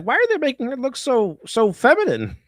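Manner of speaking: high, whiny mock outrage, pitched well up; mocking outraged fans. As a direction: sneer at them in a high mock-offended voice. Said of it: "everyone got triggered over the fact that".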